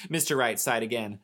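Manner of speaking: usual speaking voice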